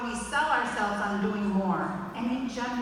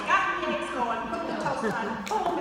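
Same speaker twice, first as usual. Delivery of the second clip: ordinary delivery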